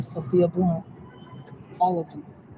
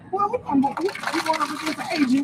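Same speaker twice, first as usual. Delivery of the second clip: excited hype; 10 semitones higher, faster than usual. Real, not acted. Excited hype about opponent.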